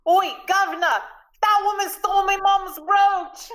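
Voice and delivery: high Cockney shout of a street ruffian; strong Cockney accent. Acted. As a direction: call out in broad, high-pitched Cockney. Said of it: "strong Cockney accent".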